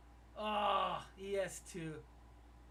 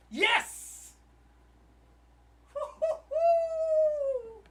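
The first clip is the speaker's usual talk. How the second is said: high, loud celebratory whoop; real jubilant yell; very excited; 14 dB louder, much slower than usual. Real, not acted. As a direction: let out a long, drawn-out woo-hoo. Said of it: "I'm just so freaking excited".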